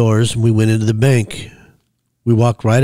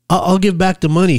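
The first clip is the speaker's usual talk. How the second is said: higher-pitched, stammered hasty offer; quick reply; 9 semitones higher, faster than usual. A narrator's reading. Frightened woman offering to repay, hasty and high. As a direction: stammer the first word, plead in a raised pitch. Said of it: "she quickly replied".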